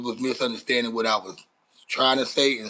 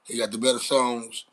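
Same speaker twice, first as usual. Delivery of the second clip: usual speaking voice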